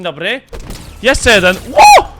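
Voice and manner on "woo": high, loud victory whoop; sudden shrill cheer of triumph; excited whoop. Real, not acted. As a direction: whoop like you just won. Whoop after second kill.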